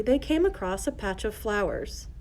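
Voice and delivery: ordinary delivery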